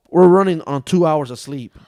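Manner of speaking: loud exhausted groan; exasperated. Real, not acted. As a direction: blurt out how tired you are. Exhausted exclamation.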